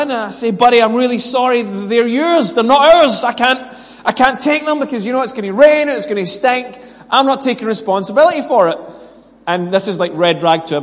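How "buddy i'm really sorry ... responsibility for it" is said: higher, insistent retold argument; re-enacted exasperated pleading; acted protest. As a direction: argue your case back at the neighbour. Acts out his side of quarrel.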